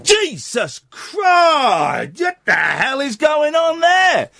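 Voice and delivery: high, slow startled shout; shocked yell. Retold or imitated. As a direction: cry out in shock. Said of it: "two moments where I went".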